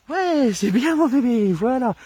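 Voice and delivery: high-pitched loving praise for the dog. Shown as a demonstration. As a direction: gush over the puppy in a high voice. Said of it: "donc une voix aiguë".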